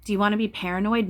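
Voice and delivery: normal talking voice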